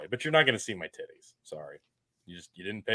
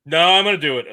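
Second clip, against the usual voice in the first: insistent; 6 semitones higher, 12 dB louder, much faster than usual. Real, not acted. Insisting to co-host.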